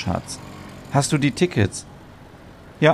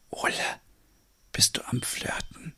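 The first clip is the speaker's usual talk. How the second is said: voiceless whisper, much softer; 13 dB quieter than usual. Acted. Stina teasing Ole about flirting with the farmer, whispered. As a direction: hiss the question right into his ear. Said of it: "Stina flüstert".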